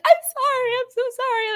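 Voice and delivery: very high, squeaky rushed apology; high-pitched mock-panicked apology to a pedestrian; apologetic squeak. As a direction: squeal out a frantic sorry. Apologizing to pedestrian while driving.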